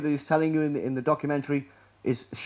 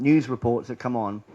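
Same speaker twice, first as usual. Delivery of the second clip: usual speaking voice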